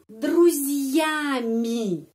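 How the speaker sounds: друзьями stretched very slowly, falling at the end; very slow model word. Shown as a demonstration. Teacher slowly modelling друзьями for learners. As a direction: draw out друзьями syllable by syllable. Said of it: "se dengan teman-teman".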